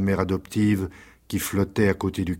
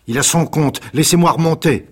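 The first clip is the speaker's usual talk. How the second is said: raised, higher call rising up from a cellar; voice from the cave; 8 semitones higher, 7 dB louder than usual. A narrator's reading. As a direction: Zampa calling loudly up from below. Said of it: "une voix monta des profondeurs de la cave".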